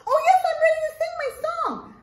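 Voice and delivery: high, bright little monkey puppet; squeaky puppet voice. Acted. Librarian voicing Arturo the monkey puppet to the children, high excited puppet voice. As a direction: pitch way up and chatter like an excited puppet.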